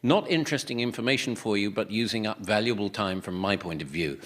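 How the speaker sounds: deadpan low voice. Pretend. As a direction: drone on to waste time. Stalling with slow time-wasting talk.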